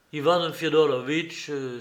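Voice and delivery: plain reading voice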